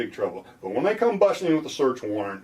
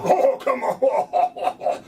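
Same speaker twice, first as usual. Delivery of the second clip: high, slow laughing breathy "oh, come on"; laughing mock cop; 12 semitones higher, 4 dB louder, much slower than usual. Lawyer mocking a cop's scoff, laughing. Officer's exasperated look, voiced.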